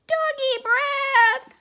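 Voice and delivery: very high, loud, slow scream of panic; screaming mice. Acted. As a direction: shriek like terrified tiny soldiers. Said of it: "screamed the soldiers".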